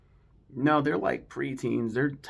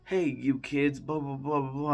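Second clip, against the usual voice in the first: higher mock scolding, trailing into blahs; imitating Vinny; 4 semitones higher than usual. Vinny goes off at the kids, lightly mocked scolding. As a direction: lightly act out a heckler calling to kids. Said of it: "it shows Vinny, and he's like".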